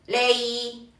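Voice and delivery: "lei" held long with a slight bend; curved tone. Shown as a demonstration. Teacher repeating the model for learners, long curved "lei". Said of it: "curvear un poco el tono".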